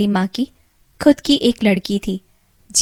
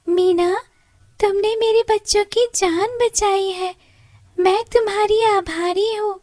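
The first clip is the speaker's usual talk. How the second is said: much higher little bird voice; high bird voice giving thanks; grateful bird; 12 semitones higher than usual. Acted. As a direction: thank her sweetly as a small bird.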